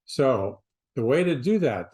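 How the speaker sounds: normal talking voice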